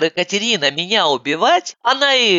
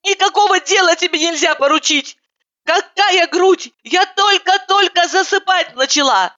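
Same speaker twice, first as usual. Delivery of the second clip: high, fed-up scolding; indignant scolding; 11 semitones higher than usual. A narrator's reading. Narrator scolds roommate for waking her, high indignation.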